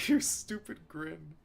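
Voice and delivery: ordinary delivery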